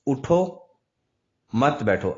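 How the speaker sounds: "اٹھو" rising, a clear stop, then "مت بیٹھو"; pause after اٹھو. Shown as a demonstration. Teacher shows how a stop changes meaning, pausing after اٹھو. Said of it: "اب میں نے اٹھو پر رکا".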